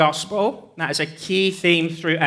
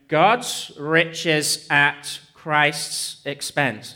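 very slow, word by word; spelled-out acronym; much slower than usual. Shown as a demonstration. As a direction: separate each word so the initials stand out. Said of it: "an acronym".

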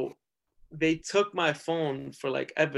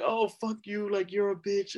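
higher, cocky jeer at the cop; mouthy insult; 6 semitones higher than usual. Retold or imitated. Friend taunting the arresting cop, high cocky jeer.

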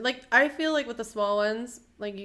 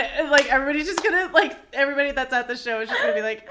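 giggly tickle attack; 4 semitones higher, 8 dB louder, faster than usual. Real, not acted. Laughing fast and excited.

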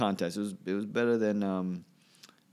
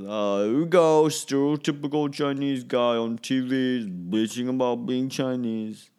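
slow, louder eye-rolling complaint; exasperated viewer; 4 semitones higher, 5 dB louder, slower than usual. Retold or imitated. Viewer griping about the Chinese guy on TV, slow exasperated drawl. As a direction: drag it out like a fed-up critic. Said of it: "it becomes".

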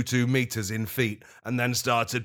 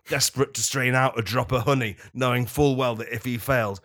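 usual speaking voice

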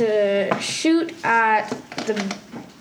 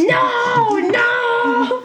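high, drawn-out wail of refusal; long high-pitched protest; acted protest; 11 semitones higher than usual. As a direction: cry out in mock alarm, pitched right up.

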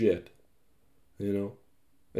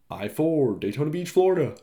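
ordinary talk, nothing marked

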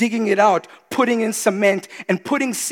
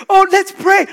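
mocking voice; 11 semitones higher, 9 dB louder than usual. Mocking a sulking believer.

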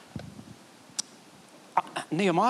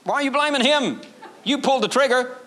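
incredulous retort; 8 semitones higher than usual. Real, not acted. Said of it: "preacher's retort".

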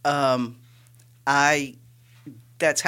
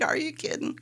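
mock disbelief; 5 dB quieter than usual. Retold doubters scoffing.

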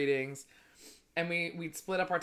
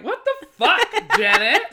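loud, slow disbelieving yell through laughter; laughing outraged yell; 14 semitones higher, 12 dB louder, slower than usual. Retold or imitated. To the snarky psychic, a laughing outraged yell. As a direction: shout it at the psychic, cracking up. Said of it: "And I'm like".

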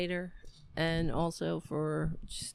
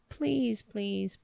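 usual speaking voice

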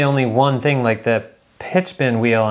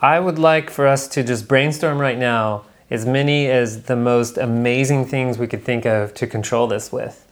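usual speaking voice

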